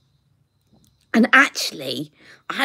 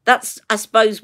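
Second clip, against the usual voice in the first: normal talking voice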